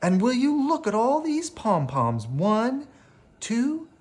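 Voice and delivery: high, thrilled counting of each pom-pom; delighted counting. Acted. Missus Goldman counting Sophia's pom-poms in high delight. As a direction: marvel at them one by one.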